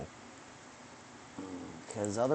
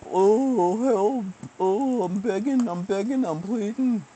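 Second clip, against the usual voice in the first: mock pleading; 8 semitones higher than usual.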